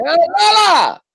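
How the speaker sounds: high, loud call to a deaf dog; yelling at dog. Retold or imitated. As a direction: shout the dog's name across the yard. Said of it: "I have to yell at mine 'cause she's deaf".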